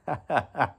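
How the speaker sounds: brief amused laugh; short chuckle. Real, not acted. Host teases guest about the viewers, short chuckle. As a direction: chuckle at your own remark. Joking about the watching audience.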